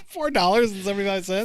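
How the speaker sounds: laugh, then echo the tiny sum in disbelief; incredulous laugh. Real, not acted. Shocked at tiny bet.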